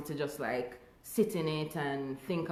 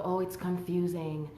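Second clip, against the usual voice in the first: higher, whiny mock excuse; mocking whine; 3 semitones higher than usual. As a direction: imitate a lazy excuse-maker. Mocking people who misgender her.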